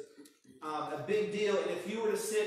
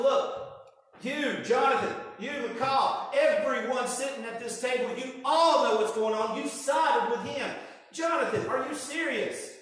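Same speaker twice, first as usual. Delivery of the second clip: furious king; 4 semitones higher than usual. Retold or imitated. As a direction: rage at the whole table. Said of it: "he's just angry".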